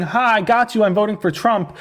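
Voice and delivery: mock someone's gotcha moment; mocking gotcha quote. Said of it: "By saying".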